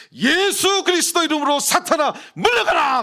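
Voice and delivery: high, full-force commanding shout; loud exorcism prayer. Retold or imitated. Pastor commanding Satan to leave in Jesus' name, loud shouted prayer. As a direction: shout it out to drive Satan away. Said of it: "큰 소리로 기도하고".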